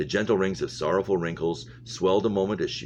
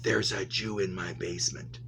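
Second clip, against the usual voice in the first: hushed secret, said softly into an ear; a soft, secret murmur of a hidden truth; imagined whisper; 2 semitones higher, 6 dB quieter than usual. A narrator's reading. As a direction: softly confide it, barely voiced. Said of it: "whispering in the woman's ear".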